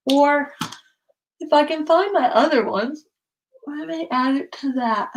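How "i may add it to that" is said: words stretched by a yawn. Real, not acted. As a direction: talk through a sleepy yawn. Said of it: "Sorry, I don't mean to yawn".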